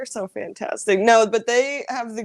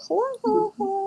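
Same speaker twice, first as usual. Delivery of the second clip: high sing-song hello; 12 semitones higher, faster than usual. Pretend. Greeting the live chat, high sing-song hello.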